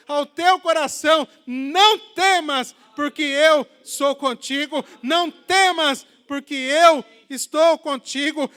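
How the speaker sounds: very loud, high proclamation, key words drawn out; fervent, booming declaration of faith; preaching at full force. Acted. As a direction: thunder God's promise over the congregation. Proclaims God's words to congregation.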